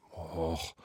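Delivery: low, soft groan; weary little "och" of reluctance; reluctant groan. A narrator's reading. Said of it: "stöhnte der Mann".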